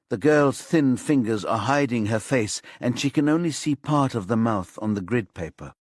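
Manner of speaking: ordinary delivery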